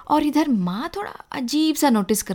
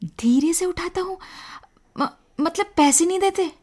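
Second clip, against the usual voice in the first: higher-pitched, stammering disbelief; astonished mother; 6 semitones higher than usual. A narrator's reading. Mother questions son about not paying, astonished. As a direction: echo it back in surprise. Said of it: "माँ आश्चर्य में बोली".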